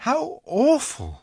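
high, slow, sympathetic Lucy; dismayed little girl. A narrator's reading. Lucy hearing of winter without Christmas, dismayed. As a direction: draw it out in high-voiced dismay.

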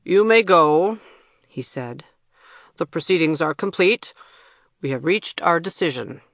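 usual speaking voice

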